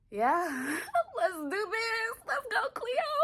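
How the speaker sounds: high, crying with joy; happy tears. Real, not acted. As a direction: cheer through real happy tears. Joyful tears over the good news.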